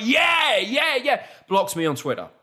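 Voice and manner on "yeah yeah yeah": excited agreement. Retold or imitated. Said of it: "I was like".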